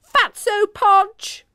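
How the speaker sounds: high, loud taunting shout. A narrator's reading. Poppy shouting an insult after Emma, loud and high. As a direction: yell the insult after her. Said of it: "Poppy shouted after her".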